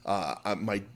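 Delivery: usual speaking voice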